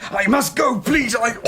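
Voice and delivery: high, loud desperate plea; urgent cry. Acted. Stranger begs the captain to let him go, loud cry.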